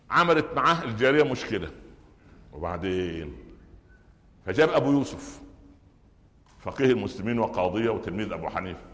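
ordinary talk

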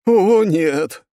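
loud, high cry of dismay. Acted. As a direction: groan it out in horror. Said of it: "хором сказали мои бледные сопартийцы".

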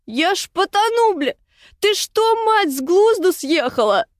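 high, frantic cry of someone drowning; panicked croak. A narrator's reading. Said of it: "прохрипела в смертельной панике, отплёвываясь".

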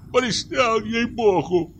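high, wailing complaint; pained annoyance. A narrator's reading. Forester fends off the hunter, plaintive complaint. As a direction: whine it out, pitched right up. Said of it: "поморщился лесник".

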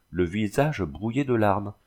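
ordinary delivery, nothing marked

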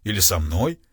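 ordinary delivery

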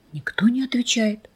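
whispered, hushed narration. A narrator's reading. Narrator telling the silence, whispered.

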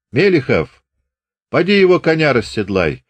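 a light commanding call; sergeant's order. A narrator's reading. Sergeant ordering Grigory to unsaddle the horse, lightly voiced. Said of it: "приказал урядник".

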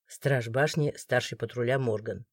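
low, quiet official introduction; gloomy, deep-voiced authority; stern guard. A narrator's reading. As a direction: state rank in a grim, deep undertone. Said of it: "Такого строгого, можно даже сказать, мрачного".

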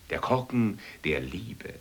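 ordinary delivery

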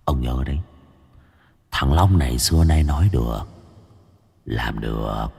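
low, hushed gangster menace; a hint of a gloating thug; Long Nghiện's threat. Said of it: "câu nói của Long Nghiện".

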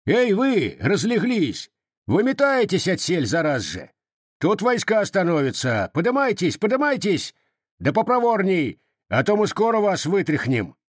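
high, bullying yell; shouted order. A narrator's reading. Armed Cossack shouting refugees out of their beds. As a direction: rouse the sleepers with threats. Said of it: "крикнул".

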